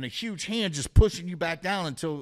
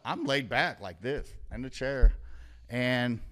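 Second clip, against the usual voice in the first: ordinary delivery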